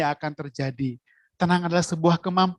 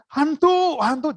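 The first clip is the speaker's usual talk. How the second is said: high startled cry of ghost; frightened shout; 10 semitones higher, slower than usual. Retold or imitated. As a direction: shriek it as if seeing a ghost. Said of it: "mereka teriak-teriak".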